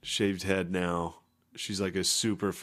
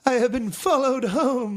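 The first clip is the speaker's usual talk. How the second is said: pitched way up, louder, laughing; high mock wail; 6 dB louder than usual. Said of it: "So have I".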